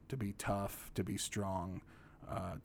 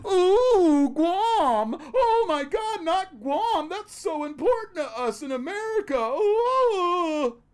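much higher, loud falsetto mock panic; squealing sarcastic dismay; sarcastic mock fear; 9 dB louder, slower than usual. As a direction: feign horror at losing Guam. Sarcastic mock alarm over Guam.

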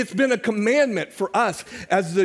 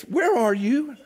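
mock sweet voice of a friend, pitched higher; sweet little voice; 6 semitones higher, slower than usual. Pastor imitating his secretary's sweet phone call. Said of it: "in that sweet little voice of hers".

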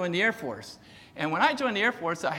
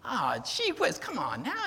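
higher, drawn-out mock "ow" and complaint; exasperated yelp; 6 semitones higher, slower than usual. Rider scolding the donkey that squashed his leg, frustrated. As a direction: act out pained frustration, easing off toward the end. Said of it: "he gets all frustrated more".

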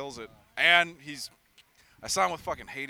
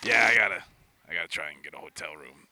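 low, fast mock Rutan voice; low imitation; 12 semitones lower, 3 dB louder, faster than usual. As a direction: drop low to imitate a bandmate. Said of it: "Rutan's like".

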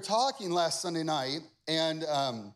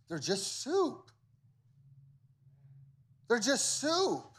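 higher-pitched, punchy sermon delivery; preacher pressing the point, pitch raised; emphatic preaching; 7 semitones higher than usual. Real, not acted. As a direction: drive each point home to the congregation. Preaching with full intensity.

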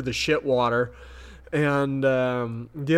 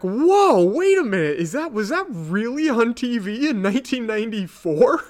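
high, loud shocked whoa, then incredulous; a double-take at the screen; stunned disbelief; 8 semitones higher, 4 dB louder than usual. Retold or imitated. Amazed reaction to a TV scene.

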